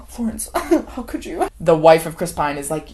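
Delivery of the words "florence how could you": high gasp, scandalized, laughing through it; mock shock. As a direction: gasp like an appalled guest. Acting out shocked dinner guests.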